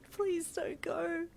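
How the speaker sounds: high, drawn-out anguished begging; anguished plea. Acted. Patient begging a partner not to go, anguished. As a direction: beg slowly, high and anguished. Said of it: "you whispered in an anguished voice".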